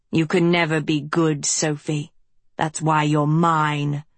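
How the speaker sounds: low, menacing villain voice; a cold, creeping threat; sinister old villain. A narrator's reading. Villainous schoolmaster taunts Sophie.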